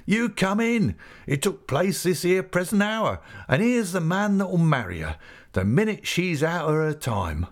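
Peggotty introducing Ham to the company, gleeful; jubilant. A narrator's reading. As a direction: proudly present the bridegroom. Peggotty's triumphant speech.

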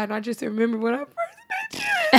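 mock crying, pitch climbing high; tearful crybaby. Friend reminiscing about first meeting, mock tearful. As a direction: imitate a friend choking up mid-compliment. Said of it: "she is the biggest crybaby".

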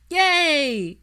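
put-on high, loud little-kid yay; children cheering. Two boys cheering when Draco gives in, high loud child voice. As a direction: burst out with a child's delight.

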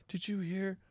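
high, soft mock-offended voice; touchy complainer. Mocking people with too many feelings, high offended whine. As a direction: imitate an easily hurt comic complaining. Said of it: "People have too many feelings".

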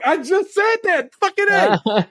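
very high-pitched, excited outburst; excited vindication. Real, not acted. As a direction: burst out high, thrilled to have guessed it. Sees their own guess confirmed.

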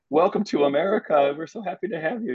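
put-on gushing drunk welcome to a newcomer; drunkenly. Tipsy welcome to the bride who speaks no English, high and gushing. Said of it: "drunkenly, like, like".

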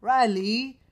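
loud, high mock English accent on "Riley". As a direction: mimic a child saying "Riley" with an English accent. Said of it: "they have this weird accent".

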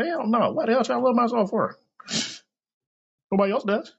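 high, pushed indignant retort; heated outburst. Real, not acted. Man ranting about self-love, high indignant outburst.

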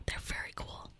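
soft whispered ASMR voice. Pretend. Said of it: "A S M R style".

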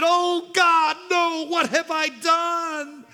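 high, desperate begging; wailing plea of a mother; pleading mother. Acted. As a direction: beg for your child's life. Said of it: "she begged and pleaded".

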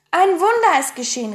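high, loud exclamation; calling out. A narrator's reading. Pippi announces their rescue on the island, calling out. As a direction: cry out at a miracle. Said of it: "rief Pipi".

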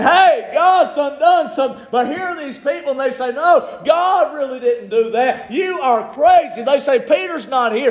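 high, urgent pulpit delivery; excited revival preacher building to a climax; full-force preaching. Acted.

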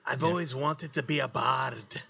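practised put-on Scottish accent; would-be bard with a Scottish accent. Shown as a demonstration. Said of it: "like, my Scottish accent".

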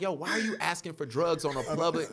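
high, loud comic scolding; loud, incredulous retelling; mock exasperation.

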